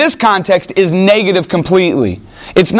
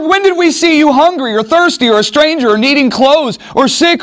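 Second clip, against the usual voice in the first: high, insistent, higher pitch; indignant protest; 8 semitones higher than usual. Acted. Preacher voicing cursed servants protesting to the king, high and insistent. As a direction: protest you'd have done it.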